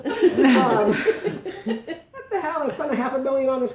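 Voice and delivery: high, drawn-out laughing what the hell; laughing mock outrage. Real, not acted. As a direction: fake indignation through laughter. Mock outrage at her son.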